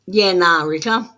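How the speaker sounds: loud broad Australian "Yeah, nah"; Australian accent. Shown as a demonstration. Reader greeting viewer Rico in a loud Australian accent. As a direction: punch it out like a true-blue Aussie. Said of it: "Doing an Australian thing here".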